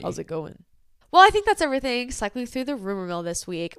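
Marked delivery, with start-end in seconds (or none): 0.0-0.6 low smoky voice. Acted. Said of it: "talk like this".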